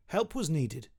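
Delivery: ordinary delivery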